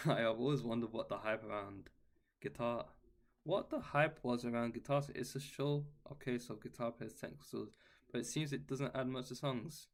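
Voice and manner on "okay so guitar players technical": normal talking voice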